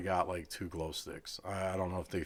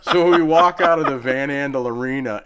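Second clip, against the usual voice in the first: laughing; 7 semitones higher, 16 dB louder than usual. Real, not acted. Still laughing after the throw.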